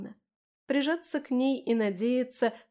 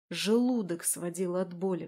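usual speaking voice

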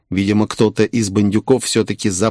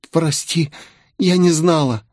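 high, small female voice; meek tearful apology; 7 semitones higher than usual. A narrator's reading. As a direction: apologise like a scolded young woman. Tearful woman apologises to commander. (виноватый высокий голосок)

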